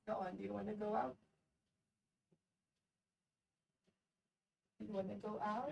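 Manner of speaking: coaxing the dog out, quick and soft; talking to the dog. Real, not acted. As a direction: softly urge the dog outside.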